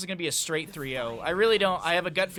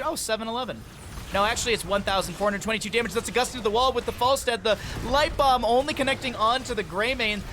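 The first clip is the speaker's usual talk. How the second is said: fast, loud, rising caster call; excited play-by-play; 6 semitones higher, 4 dB louder than usual. Real, not acted. Caster calling the fight to viewers, excited and fast. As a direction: ride the hype of the fight.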